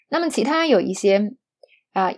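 usual speaking voice

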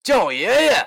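嚣张跋扈地命令; arrogant demand. Acted. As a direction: lord it over him. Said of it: "尤西嚣张跋扈地说".

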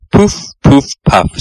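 mock puffing breaths, blowing hard; puffing blows. A narrator's reading. Toad blowing thistledown round the room, puffing. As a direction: blow the words out like scattering thistledown. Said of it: "He blew the thistledown".

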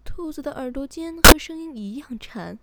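ordinary delivery, nothing marked

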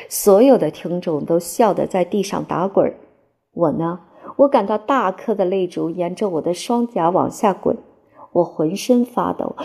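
ordinary delivery

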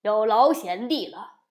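loud hearty toast; loud jovial thanks; toasting demon king. A narrator's reading. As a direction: raise a cup to his brother. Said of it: "金角笑着跟银角敬酒".

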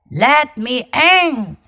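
slow big bad wolf voice. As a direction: play the wolf, drawn out. Wolf speaking in read-aloud story.

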